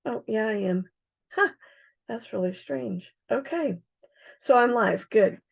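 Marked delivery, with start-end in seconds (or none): none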